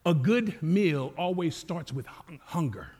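slow, imitated thick French accent. Waiter lecturing the hesitant American diner, slow thick French accent. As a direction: lean into a hammed-up French accent. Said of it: "He says that in a real thick French accent".

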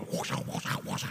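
soft breathy whoosh like a heartbeat monitor; ultrasound whoosh. Retold or imitated. Imitating the ultrasound machine for the congregation, soft whooshing. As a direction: mimic the ultrasound machine quietly. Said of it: "that machine, it go".

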